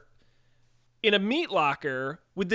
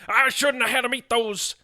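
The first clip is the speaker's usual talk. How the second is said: Burgess Meredith impression; 4 semitones higher than usual. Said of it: "his great yelling impression".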